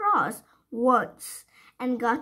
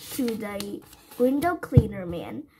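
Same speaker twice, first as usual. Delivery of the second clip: ordinary delivery, nothing marked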